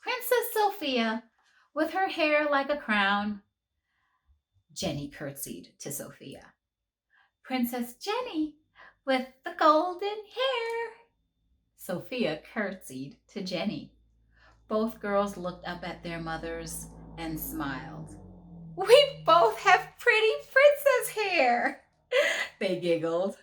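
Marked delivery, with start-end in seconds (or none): 0.0-3.4 put-on playful little girl. A narrator's reading. Jenny playfully curtsies. 7.4-10.9 put-on playful little girl. A narrator's reading. Sophia playfully curtsies back. 18.8-22.6 put-on happy little girls. A narrator's reading. Both girls giggling together.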